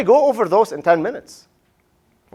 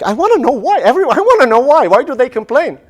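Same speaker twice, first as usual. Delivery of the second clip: much higher, louder, fast curious voice; eager audience member; 7 semitones higher, 5 dB louder than usual. Retold or imitated. Professor voicing an intrigued audience member, eagerly. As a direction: play a listener dying to know the answer. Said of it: "So that triggers something like".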